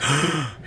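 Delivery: sharp crowd gasp; a quick shocked intake of breath; startled gasp from the onlookers. Retold or imitated. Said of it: "everybody in there is like".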